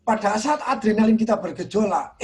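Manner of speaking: ordinary delivery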